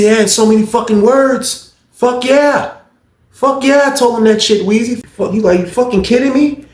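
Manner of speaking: high, loud heated ranting; imitated angry rant. Guard snapping about the roommate, high loud imitated rant. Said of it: "He was just snapping".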